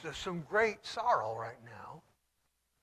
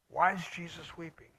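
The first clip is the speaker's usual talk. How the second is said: ordinary talk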